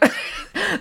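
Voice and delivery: sudden high-pitched giggle; high burst of laughter. Real, not acted. Giggle mid-story.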